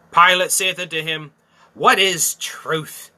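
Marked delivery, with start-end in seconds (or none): 1.7-3.1 slow scornful question. Acted. Pilate's question read slowly.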